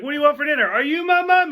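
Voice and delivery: high, loud put-on child voice; creepy kid catchphrase. Forcing the phrase into dinner talk, high creepy child voice. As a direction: pitch it way up and pester. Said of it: "I can fit that phrase in".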